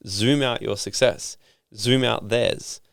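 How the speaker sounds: drawn-out, deliberate repetition; slow emphatic advice. Real, not acted. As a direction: let each word land slowly. Repeats the advice slowly.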